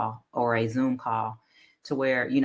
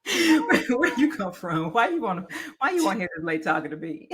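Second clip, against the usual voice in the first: teasing suave man, laughing; a smooth-talking AI asking questions; flirty man voice; 8 semitones higher than usual. Retold or imitated. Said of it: "I'm like".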